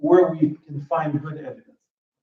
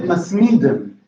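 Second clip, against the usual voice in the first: "masmidim" stressed on MI, with a full vowel; shifted penultimate stress; 3 dB louder, slower than usual. Shown as a demonstration. Teacher giving the plural, "masmidim" stressed on MI. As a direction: hit the middle syllable of "masmidim". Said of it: "there is a shift in stress".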